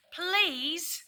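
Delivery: high pleading please; begging. Acted. Daisy begging Bella for sweets, high pleading voice. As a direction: beg sweetly like the dog. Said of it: "begged Daisy".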